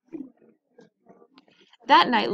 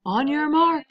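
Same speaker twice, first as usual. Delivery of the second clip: loud, high coach's call; bellowing coach; 6 semitones higher, 5 dB louder than usual. A narrator's reading. Coach calling the start of the race, loud bellow. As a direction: bellow it across the pool. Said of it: "bellowed the coach".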